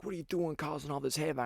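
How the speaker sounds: high-pitched mock yell at normal volume; retold yelling. Girl comes in yelling at me amid the chaos, high mock yell. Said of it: "this girl starts yelling at me".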